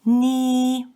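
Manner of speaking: "ni" held high, straight and long; long, level, high-pitched "ni"; first tone. Shown as a demonstration. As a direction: sustain "ni" on one high level note. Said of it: "pronounced with the first accent".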